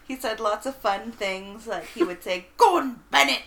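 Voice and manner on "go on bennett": loud, higher mock Cockney call; Cockney stepdad. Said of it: "very Cockney accent".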